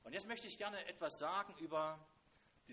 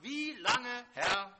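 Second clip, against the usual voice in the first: loud, high lament cry; crying out to God; 7 semitones higher, 11 dB louder, much slower than usual. Acted. Habakkuk crying out to God, loud anguished lament. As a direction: call out in anguish to the Lord. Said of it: "Er kommt zu Gott und er sagt".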